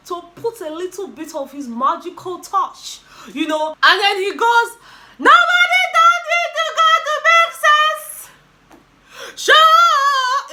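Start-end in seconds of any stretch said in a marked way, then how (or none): 5.2-10.5 high-pitched, full-volume imitation of a yelling producer; mimicked producer shouting. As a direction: yell it out way up high, stretching the last word. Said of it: "he shouted".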